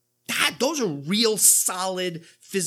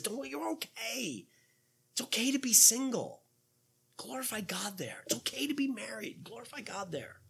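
gentle reassurance; 2 semitones higher, 7 dB quieter than usual. Acted. Paul easing the Corinthians' consciences.

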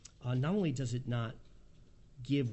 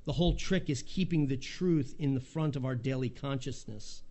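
normal talking voice

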